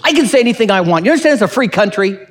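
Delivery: a wife brushing off concern; hammed-up defensive retort; snapping wife. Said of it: "And she says".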